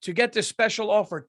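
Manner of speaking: normal talking voice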